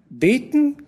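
hoch, mit Lachen gesprochen; lachend spöttisch. Acted. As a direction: kichernde Anzüglichkeit. Wirtin spöttelt über Fräulein. (die Wirtin lästert kichernd über das Fräulein)

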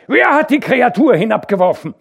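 loud, high accusing shout; angry demand. A narrator's reading. Hauke angrily demanding who threw the dog. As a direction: press them hard for an answer.

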